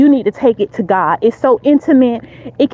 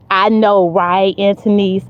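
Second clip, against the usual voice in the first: ordinary talk, nothing marked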